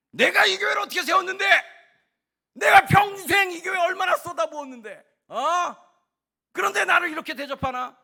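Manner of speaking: high, very loud indignant shout; 분개한 호통. Acted. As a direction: bellow it like someone owed respect. 공로의식에 사로잡힌 사람의 분노를 연기. (억울해서 버럭 지르는 고함)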